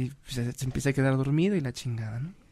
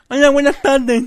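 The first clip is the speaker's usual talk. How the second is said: mocking nasal-voiced passenger, high and loud; nasal woman imitation; 9 semitones higher, 11 dB louder than usual. Friend retelling the bus story imitates a nasal passenger, high and loud. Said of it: "Se sube una gangosa".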